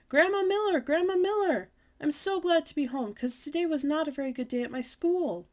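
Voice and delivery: high, loud call through the house. Acted. Girl runs home hollering for her grandma, loudly.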